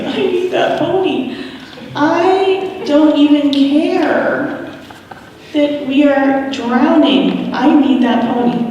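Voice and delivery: high, pleading I-need-it voice, slowing down; desperate pony plea. Retold or imitated. Mother voicing her need for the pony, pleading high. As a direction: beg for the pony like a desperate kid. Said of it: "I was like".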